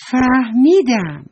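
a doll's thrilled realisation, drawn out; eureka. Acted. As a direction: stretch out «فهمیدم» like a sudden bright idea. Doll hits on an idea.

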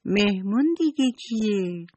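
a hint of childish surprise; lightly voiced girl wondering who is coming; surprised child. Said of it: "حنانه با تعجب پرسید".

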